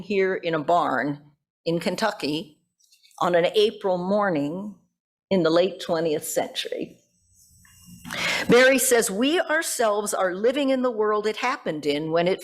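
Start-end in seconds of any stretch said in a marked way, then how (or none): none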